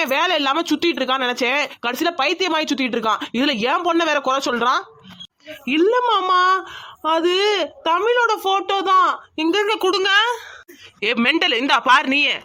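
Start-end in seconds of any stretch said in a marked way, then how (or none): 5.8-10.1 high, insistent denial; flustered protest. Acted. Nephew insisting to uncle it is her photo, flustered protest. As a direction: protest that they must be mistaken.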